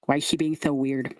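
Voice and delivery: lightly voiced blunt lisping creature; lisping scarecrow kid. Kid questions Lulu about her odd friend, lisping. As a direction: ask bluntly through a lisp. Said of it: "The lisp".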